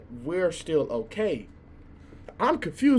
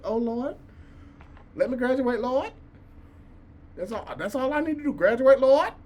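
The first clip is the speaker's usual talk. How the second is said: raised, pleading prayer to the Lord, half-joking; mock prayer; 7 semitones higher than usual. Student begging the Lord to let him graduate, mock prayer. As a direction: beg the Lord like a desperate senior, playfully.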